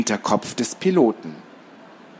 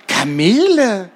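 amazed whisper; 8 semitones higher, 4 dB louder, slower than usual. Acted. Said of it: "flüsterte Gerti".

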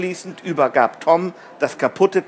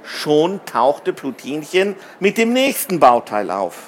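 ordinary delivery, nothing marked

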